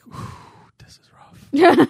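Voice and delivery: high, slow, drawn-out whisper; whispered cringing reaction; pained whisper. Retold or imitated. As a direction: wince through a whispered verdict. Reacting to bad voice acting.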